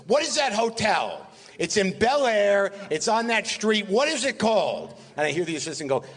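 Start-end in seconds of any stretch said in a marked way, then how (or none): 0.1-5.0 high, slow, drawn-out mock exasperation; Mel Brooks. Mel demanding the hotel's name from his assistant, hammed-up impatience. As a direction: imitate impatient calling out, stretching every phrase. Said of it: "He goes".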